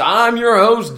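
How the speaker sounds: ordinary talk, nothing marked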